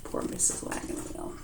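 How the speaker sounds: soft pitying whisper to the listeners; whispered aside. A narrator's reading. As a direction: breathe it out in sympathy.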